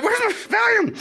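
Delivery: high, squeaky panicked plea; mock panic. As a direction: whine it in comic panic. Panicking in front of gunmen.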